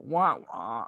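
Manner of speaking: sad-trombone style wah-wah; imitated mocking "wah, wah". Fan imitating a character's background jeer, mock wah-wah. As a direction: drop it like a comic failure sting. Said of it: "you just hear Kano in the background go".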